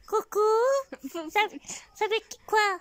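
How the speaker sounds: high squeaky puppet voice for the teddy; teddy bear voice. Pretend. Grandmother voicing the teddy bear for her granddaughter, high and squeaky. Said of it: "et je parle comme ça avec lui".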